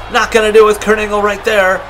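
ordinary talk

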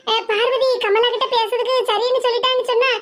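normal talking voice

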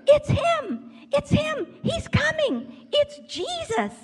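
very high-pitched happy cheering cry; excited crowd shouting. Acted. Someone in the crowd spots Jesus coming, excited high shout. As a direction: squeal it out like a thrilled onlooker. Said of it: "people were shouting, and they were cheering".

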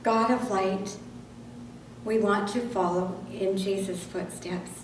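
ordinary talk, nothing marked

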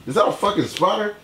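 high, sudden startle; startled. Real, not acted. Spotting a spider mid-video, a startled high cry. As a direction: jump at the sight of a spider.

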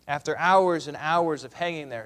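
normal talking voice

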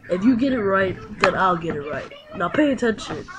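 normal talking voice

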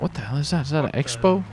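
ordinary delivery

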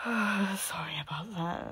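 slow sleepy whisper; yawning mutter. A narrator's reading. Narrator wakes up, slow sleepy whisper. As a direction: yawn through the words. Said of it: "yawning as I muttered".

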